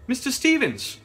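loud, high call to the driver. A narrator's reading. Lady calling the driver from the coach window, loud. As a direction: call out across the road. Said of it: "I called out".

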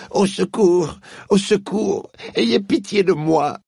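high, loud desperate begging witch, slowing at the end; pleading moan. Acted. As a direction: beg for mercy as she stumbles. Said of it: "gémissait la sorcière d'une voix affaiblie". (supplication désespérée)

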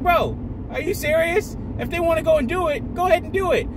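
usual speaking voice

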